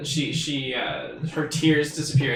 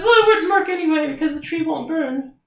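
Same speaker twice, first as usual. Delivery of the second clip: high matter-of-fact fairy; 12 semitones higher than usual. Acted. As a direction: state it in a tiny high voice. Fairy speaks.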